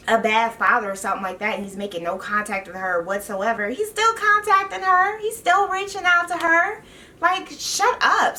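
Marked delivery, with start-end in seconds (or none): 3.7-8.4 exasperated outburst. Real, not acted. Venting at Leah on camera, exasperated and high. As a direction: let the annoyance boil over.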